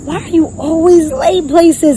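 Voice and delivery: high, slow mock scolding; berating yourself over being late; scolding self. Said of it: "I was like".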